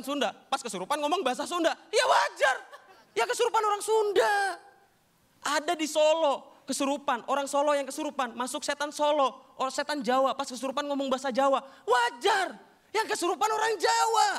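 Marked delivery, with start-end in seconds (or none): 1.9-4.3 very high-pitched, incredulous yell; exasperated shout. Acted. Comic scoffs at Sundanese possession story, exasperated shout. Exasperated retort to obvious claim. 11.9-14.3 high-pitched incredulous yell; exasperated shout. Acted. Comic scoffs at Javanese possession story, exasperated shout. Exasperated retort to obvious claim.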